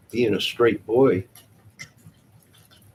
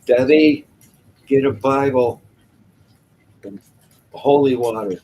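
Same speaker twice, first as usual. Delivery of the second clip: loud, raised-pitch mock panic; mock-alarmed call; 4 semitones higher, 8 dB louder than usual. Host ordering Debbie to fetch holy water, loud mock alarm. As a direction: call out urgently, playing at alarm.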